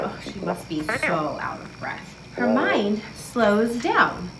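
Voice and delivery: ordinary talk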